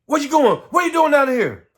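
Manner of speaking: loud, high, hostile shout; angry yell. Retold or imitated. Grown man yelling threats at a boy, loud and angry. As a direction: bark it at a scared kid.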